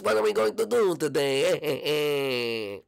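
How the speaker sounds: high, drawn-out whiny cartoon voice; annoying Luigi. Shown as a demonstration. YouTuber demos a character for viewers, annoying Luigi voice. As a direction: stretch the words out, high and obnoxious. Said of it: "that one's sort of like Luigi".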